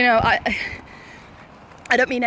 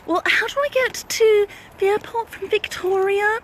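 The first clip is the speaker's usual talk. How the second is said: high, slow imitation of a puzzled woman; lost traveller; 8 semitones higher than usual. Woman asking the way to the airport, imitated puzzlement. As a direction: draw the question out high, imitating someone lost.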